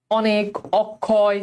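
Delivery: measured model pronunciation of অনেক, অক্ষয়, never "o" first; open অ kept. Shown as a demonstration. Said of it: "স্বরের উচ্চারণ স্বরেয়ই থাকে".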